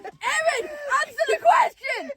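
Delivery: high, shrill-pitched demand; yelling at her friend to finally answer; exasperated shout. Real, not acted. Host demanding Erin answer the cocoa question, exasperated shout.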